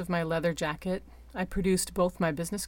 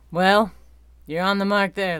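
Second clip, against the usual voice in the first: ordinary delivery, nothing marked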